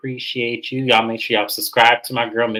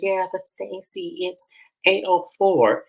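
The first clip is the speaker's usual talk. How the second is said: higher and slow, a prim little correction; mock prissy correction; 8 semitones higher, slower than usual. [name] correcting Stacy about the four minutes, mock prim. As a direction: drag the time out primly.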